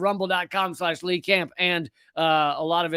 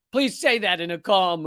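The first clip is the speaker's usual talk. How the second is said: normal talking voice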